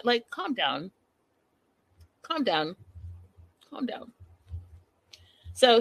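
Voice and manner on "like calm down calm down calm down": repeated, fading softer; calm down. Real, not acted. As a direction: let it die away. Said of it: "repeated advice, fading".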